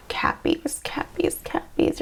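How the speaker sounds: soft whispered calling; whispering. Real, not acted. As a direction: whisper the name, drawn out. Softly calling the sign's name.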